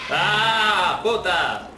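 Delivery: shouted back in mock defiance; mock yell. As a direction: yell at the monster. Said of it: "Yo también sé gritar". (grito de burla)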